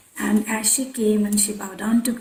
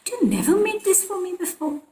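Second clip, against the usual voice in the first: higher, lilting imitation of the guru; mild mock complaint; 8 semitones higher, faster than usual. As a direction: put a light reproach on it, pitched up. Guru's teasing complaint in story.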